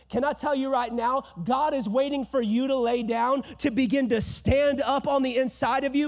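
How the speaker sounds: loud, high fiery sermon; full-force preaching. Real, not acted. Pastor rousing the congregation, fiery and loud.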